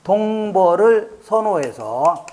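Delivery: ordinary delivery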